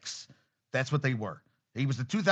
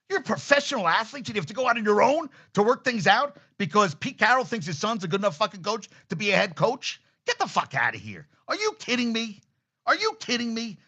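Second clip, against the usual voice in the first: higher-pitched, incredulous rant; outraged disbelief; 6 semitones higher than usual. Real, not acted. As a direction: fire off indignant, disbelieving questions. Outraged rant at coaching nepotism.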